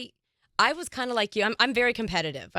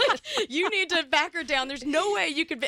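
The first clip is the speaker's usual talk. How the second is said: laughing mock critique; 4 semitones higher than usual. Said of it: "They're like".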